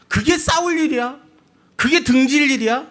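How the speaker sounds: high-pitched, incredulous quarrelling; indignant protest. Acted. People falling out over one word, indignant protest. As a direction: protest hotly, pitch jumping way up.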